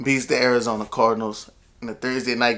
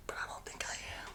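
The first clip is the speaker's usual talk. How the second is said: barely audible whisper; hushed, voiceless afterthought; whispered aside; 26 dB quieter than usual. Pretend. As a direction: drop right down to a secretive whisper. Confiding aside after bold prediction.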